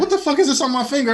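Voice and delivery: hammed-up disbelief at the ring; mock shock. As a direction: act stunned at your own finger.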